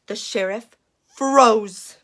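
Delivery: ordinary delivery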